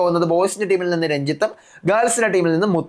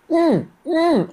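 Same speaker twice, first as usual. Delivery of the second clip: mock hum; 9 semitones higher, much slower than usual. Imitating someone's reluctant hum.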